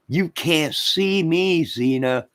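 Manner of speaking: slow, high, loud wrestler catchphrase voice; John Cena impression. Doing John Cena's taunt to the viewers, slow and loud. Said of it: "here come John Cena".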